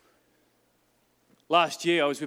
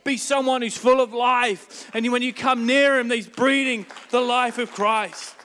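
loud, slow, driving declaration; preaching at full force; 6 semitones higher, 7 dB louder than usual. Real, not acted. Preacher urging his church, loud and slow. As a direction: lift it and hammer each phrase.

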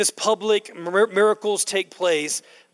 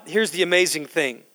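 ordinary talk, nothing marked

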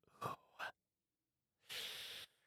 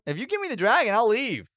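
high, raised voice; calling up; 8 semitones higher, 3 dB louder than usual. Acted. Player bargaining with the golem for her dragon, high calling voice. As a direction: pitch it up high to reach a giant.

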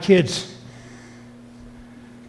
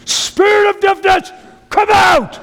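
high, slow, loud shouted command, like a TV healer casting out a spirit; hammed-up booming faith healer command; mock televangelist shout; 5 dB louder, slower than usual. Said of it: "and he says".

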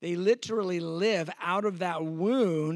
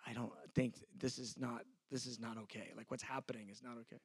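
much softer, low hurt complaint; quiet hurt protest; 6 semitones lower, 11 dB quieter than usual. Retold or imitated. Preacher retelling his quiet protest at being mistreated, much softer. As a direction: voice it under your breath, wounded. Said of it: "and I'm going".

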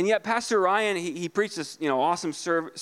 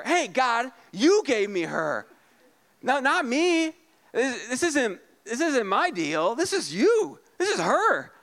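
much higher, defensive whine; mock Adam blame; 6 semitones higher than usual. Pastor voicing Adam blaming God and Eve, high whine. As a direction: push the blame away. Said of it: "He starts pointing his finger".

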